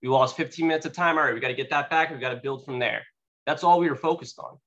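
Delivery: normal talking voice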